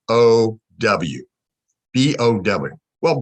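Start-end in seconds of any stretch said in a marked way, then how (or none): none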